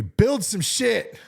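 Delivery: says it through a laugh; chuckling while announcing. Real, not acted.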